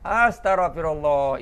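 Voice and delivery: slow, emphatic "Astaghfirullah" with a strong gh; careful pronunciation. Shown as a demonstration. Preacher drilling the right pronunciation. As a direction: lean into "Astaghfirullah", every letter sounded. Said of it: "Itu alif sin tara".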